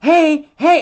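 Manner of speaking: very high, very loud yell; shouting dog. Retold or imitated. Frightened dog yelling at clueless humans, very high and loud. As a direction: scream it for help. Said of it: "I'm gonna have to shout it from the mountains".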